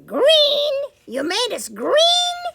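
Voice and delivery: high, loud shocked disbelief; cried. Acted. Third pig crying out at the illustrator, shocked. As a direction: cry out in outrage at the new colour. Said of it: "cried the third little pig".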